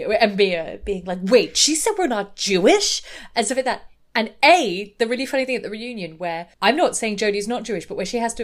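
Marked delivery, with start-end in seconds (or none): 1.2-3.0 mock shock. Quoting Mia's shocked reaction.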